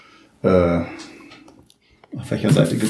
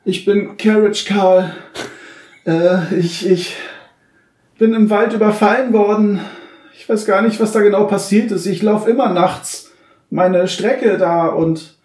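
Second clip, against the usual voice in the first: put-on shaken old pony; 10 semitones higher than usual. GM voices Carriage Carl.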